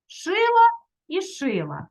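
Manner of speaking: «Шила і шила» повільно, голосніше й вище; виділити обидва «шила» як омоніми; підкреслено. Shown as a demonstration. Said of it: "омоніми?". (повільно, з наголосом)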